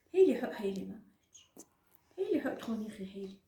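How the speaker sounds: soft unvoiced whisper. Real, not acted. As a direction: whisper to the little one. Whisper heard.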